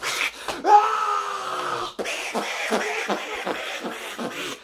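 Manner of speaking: high shrieking laugh burst. Real, not acted. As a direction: let out a big squealing laugh. Laughs at the movie plot.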